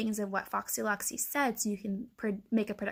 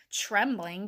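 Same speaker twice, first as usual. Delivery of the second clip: ordinary delivery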